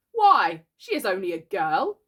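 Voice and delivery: loud, protesting disbelief; appalled, starting loud; aghast. A narrator's reading. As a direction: protest as if scandalised.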